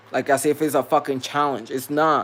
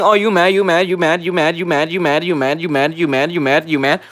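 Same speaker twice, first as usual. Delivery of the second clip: mocking needling; 2 semitones higher, 6 dB louder than usual. Imitating coworkers harping on being mad, mocking chant. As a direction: badger on and on in an even rhythm.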